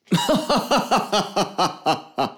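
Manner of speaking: big hearty laugh, pitched high; booming pirate laugh. A narrator's reading. Johnny laughing at Pedro's praise, booming laugh. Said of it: "sein breites Lachen ertönen".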